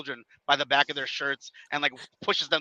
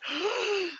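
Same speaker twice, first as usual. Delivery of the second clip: a sudden high gasp of alarm; startled intake of breath; sharp gasp; 5 dB quieter than usual. Retold or imitated. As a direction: gasp like you've just been grabbed. Retelling the moment he's grabbed.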